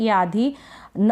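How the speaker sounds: normal talking voice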